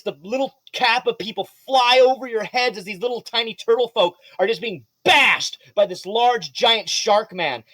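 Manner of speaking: ordinary talk, nothing marked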